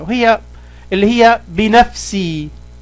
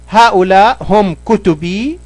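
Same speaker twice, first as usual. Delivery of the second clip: usual speaking voice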